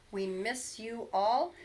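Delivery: ordinary delivery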